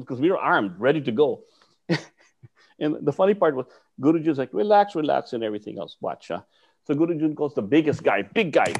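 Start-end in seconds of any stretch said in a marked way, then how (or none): 4.5-5.3 retold calming call. Teacher retold calming him.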